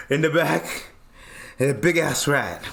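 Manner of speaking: mock out-of-breath gasped report; panting storyteller playing himself after a sprint; huffing and puffing. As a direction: act winded, gulping air between phrases. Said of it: "huffing and puffing".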